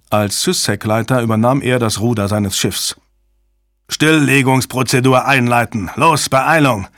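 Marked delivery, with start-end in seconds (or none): 3.9-6.9 forceful, hurried order; urgent command. A narrator's reading. Crew chief orders the shutdown, urgent command. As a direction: drive the crew to hurry.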